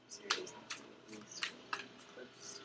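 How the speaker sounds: breathy whispered question; whispering. Real, not acted. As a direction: whisper it to the cards. Asking the cards while shuffling.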